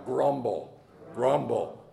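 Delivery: "grumble" said low and dark, rolled out; a dark, rumbling "grumble"; growled grumble. Shown as a demonstration. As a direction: drag "grumble" down low like a complaint. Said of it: "everybody just say that right now".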